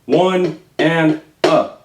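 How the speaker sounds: drawn-out One and a; counting a slow triplet. Shown as a demonstration. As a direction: stretch each subcount evenly. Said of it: "one more time".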